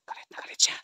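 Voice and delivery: hushed unvoiced whisper; scared whisper while hiding. Real, not acted. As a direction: keep it down so she won't hear. Hiding, whispering about witch.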